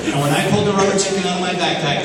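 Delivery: usual speaking voice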